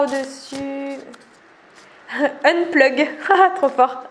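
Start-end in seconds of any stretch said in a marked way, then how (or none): none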